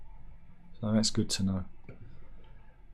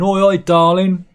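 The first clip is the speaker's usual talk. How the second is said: loud, hammed-up catcall, pitched higher; catcalling builder; 7 semitones higher, 8 dB louder than usual. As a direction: shout it across the street like a builder. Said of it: "Do you like going".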